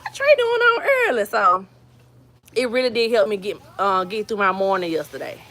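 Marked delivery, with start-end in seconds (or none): 0.0-1.2 high-pitched mock puzzlement; surprised self-talk. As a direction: pitch jumps up, acting out surprise. Acting out her surprise at seeing Trey.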